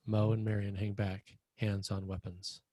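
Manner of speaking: ordinary talk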